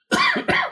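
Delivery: sudden harsh coughing; coughing fit. Real, not acted. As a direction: cough hard, choking on spit. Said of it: "Choking on my own saliva".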